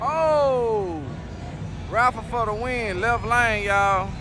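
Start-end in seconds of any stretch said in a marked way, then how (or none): none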